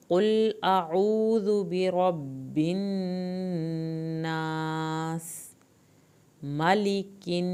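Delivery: very slow melodic recitation with long held vowels; Quranic recitation. Shown as a demonstration. Teacher recites the verse after explaining the rules, slow and chanted. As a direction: chant the verse, stretching الناس and hissing the final س. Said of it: "آخر میں سین پہ سیٹی کی آواز نکلے".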